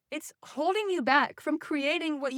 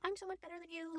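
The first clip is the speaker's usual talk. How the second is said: mock snobby; 4 semitones higher, 15 dB quieter, faster than usual. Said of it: "I'm not trying to be like".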